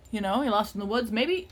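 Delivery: normal talking voice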